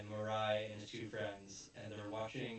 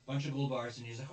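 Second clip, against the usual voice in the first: normal talking voice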